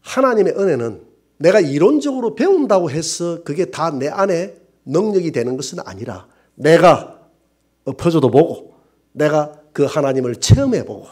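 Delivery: usual speaking voice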